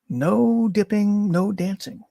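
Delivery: usual speaking voice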